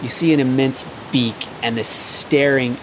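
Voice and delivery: slow, hushed build-up; game master scene setting. A narrator's reading. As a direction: draw the players in slowly, revealing a monster. GM describes the dragon turtle's emergence.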